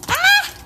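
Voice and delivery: sudden very high yelp; a real jolt of surprise; startled shriek. Real, not acted. Owner startled as her lizard bites the pliers, high shriek.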